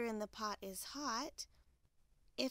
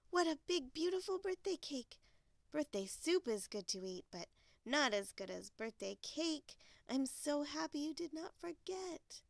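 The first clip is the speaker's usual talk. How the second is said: delighted Little Bear; 6 semitones higher than usual. Acted. Little Bear thanks Mother Bear for cake, delighted. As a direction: gush happily, starting and ending high.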